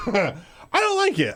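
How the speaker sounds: high, slow, laughing through the words; giggling while saying it. Real, not acted. Laughing through his own line.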